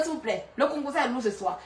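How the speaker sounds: ordinary talk, nothing marked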